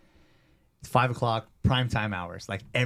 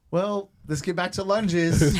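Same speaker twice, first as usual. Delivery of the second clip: higher, slow, forced cheery trainer voice; awkward deflection; 8 semitones higher, 5 dB louder, slower than usual. Retold or imitated. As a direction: brush past the tears like nothing happened. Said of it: "So I'm like".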